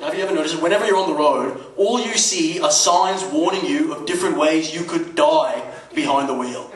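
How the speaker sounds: ordinary delivery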